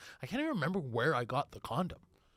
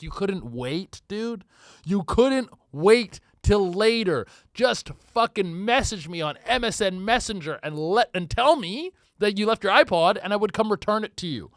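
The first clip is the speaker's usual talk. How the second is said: exasperated rant; 7 semitones higher, 11 dB louder than usual. Retold or imitated. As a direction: hiss it at her like she ruined everything. Retold exasperation at girlfriend.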